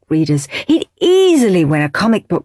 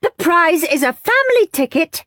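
higher, grand announcing teacher; strict teacher; 9 semitones higher than usual. Acted. Teacher revealing prize to class, grand. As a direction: present it with ceremony.

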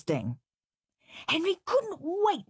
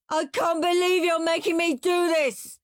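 put-on high, loud kid's scream; 12 semitones higher, 4 dB louder than usual. Henry screaming at Mum over chores. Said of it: "screamed Henry".

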